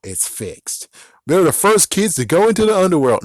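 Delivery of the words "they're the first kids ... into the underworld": higher, louder mocking of the claim; mocking quote. Vlogger mocks the show's first-kids boast, scornful. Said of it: "No, no, sorry".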